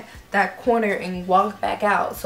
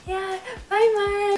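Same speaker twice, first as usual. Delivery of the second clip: giddy goodbye; 10 semitones higher, slower than usual. Retold or imitated. Fan waving off Mark.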